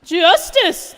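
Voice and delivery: loud, laughing cry of justice; triumphant shout. Acted. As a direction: bellow it with manic glee. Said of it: "I speak to you as Dieter Croy".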